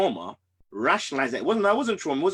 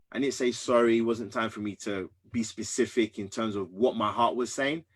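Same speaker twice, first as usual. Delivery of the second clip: ordinary delivery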